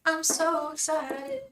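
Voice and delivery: high, drawn-out excited delivery. Real, not acted. Streamer hears roommate is ordering Chinese food, high slow excitement. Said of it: "and I feel so excited".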